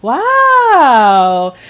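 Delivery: loud, high, long-drawn wow; hammed-up astonishment, stretched and loud; retold amazed wow. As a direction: exclaim it big and drawn out, imitating his amazement. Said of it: "And he goes".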